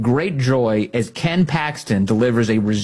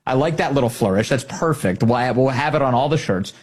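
ordinary talk, nothing marked

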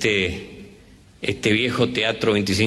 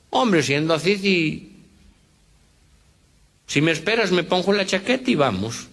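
higher, agreeable Galician voice; Galician accent; 6 semitones higher than usual. Acted. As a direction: give in cheerfully, Galician style. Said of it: "este cuento de gallegos".

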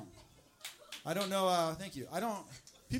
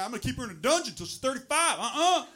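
loud Southern overprotective dad, higher; 6 semitones higher, 8 dB louder than usual. Retold or imitated. Comic mocking the protective dad, loud Southern accent. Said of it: "you know that guy".

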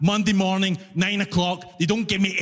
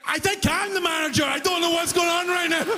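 high, raised helpless voice; panicked reply; 8 semitones higher than usual. Retold or imitated. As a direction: sound completely lost.

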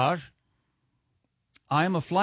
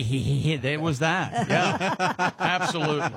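laughing. Real, not acted. Laughing at the clip.

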